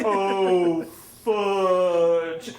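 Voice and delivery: loud, drawn-out movie-style curse; Ralphie. Retold or imitated. Storyteller quotes the hubcap scene, loud slow-motion dismay. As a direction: stretch out each word in dawning dismay. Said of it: "where little Ralphie has".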